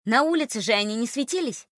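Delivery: usual speaking voice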